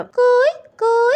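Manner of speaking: high, short koel hoots; imitated cuckoo call. Shown as a demonstration. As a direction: coo out like a cuckoo. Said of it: "കുയില് കൂവുന്ന ശബ്ദം".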